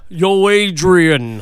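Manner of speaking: loud Rocky yell; a boxer shouting for his girl; Rocky impression. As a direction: call out to Adrian like Rocky.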